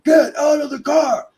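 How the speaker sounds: high, loud, barked command; cop yelling. Retold or imitated. Cop yelling at son to get out of the car, loud high shout. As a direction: yell it like a cop with a gun drawn.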